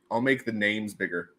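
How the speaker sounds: ordinary talk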